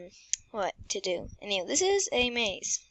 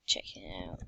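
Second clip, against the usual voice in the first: whispering; 7 dB quieter than usual. Real, not acted. Whispered trailing aside.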